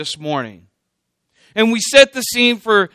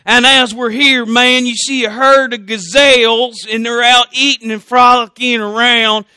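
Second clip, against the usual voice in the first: higher-pitched, hammed-up country voice; 4 semitones higher, 4 dB louder than usual. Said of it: "I do a better redneck voice".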